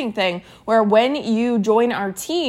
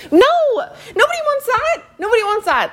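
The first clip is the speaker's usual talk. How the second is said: high-pitched, exclaimed protest; emphatic outcry; 13 semitones higher than usual. Real, not acted. Coach rejecting the boring pitch, high emphatic outcry. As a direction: cry out against it, voice shooting up high.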